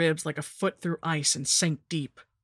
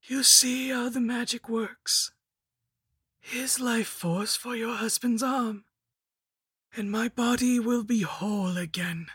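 scheming lich, triumphant; 7 semitones higher than usual. A narrator's reading. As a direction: spell it out grandly, pitched high. Lich explains the magic.

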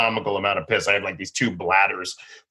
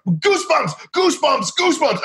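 frantic yelling. Retold or imitated. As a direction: shout it to the crew in a panic. Yelling wrong safe word at crew.

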